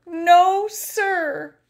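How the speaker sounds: high, loud protest; emphatic denial. Acted. Narrator protesting to listener, high emphatic no. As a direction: insist hard you weren't scared. Insisting not scared.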